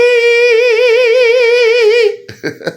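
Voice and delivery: high, loud sing-song laughter; sung laugh. Real, not acted. Vlogger laughs at Lou owning no AMC, high loud sing-song laugh. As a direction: let the laugh ring out.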